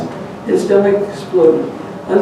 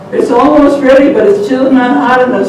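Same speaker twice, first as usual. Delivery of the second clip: higher, louder farmer; farmer's voice; 5 semitones higher, 5 dB louder than usual. Acted. Farmer calling that the poker is not hot yet. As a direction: call out about the poker. Farmer heard through the wall.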